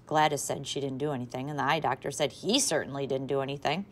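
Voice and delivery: ordinary delivery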